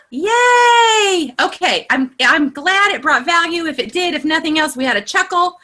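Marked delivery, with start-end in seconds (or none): 0.1-1.3 high, drawn-out excited cheer. Real, not acted. Coach cheering that the group got value, high and excited. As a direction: squeal it high like a dog whistle. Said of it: "my voice gets so high when I get excited".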